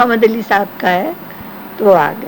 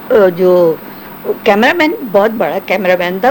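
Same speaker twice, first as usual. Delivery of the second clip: usual speaking voice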